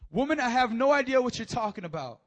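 high, loud brush-off; loud denial. Acted. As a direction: wave her off loudly. Peter denies to woman.

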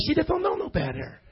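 pitying coo. Acted. Preacher pitying little Tito, indulgent coo. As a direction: feel sorry for a helpless little one. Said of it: "it's like you feel sorry for".

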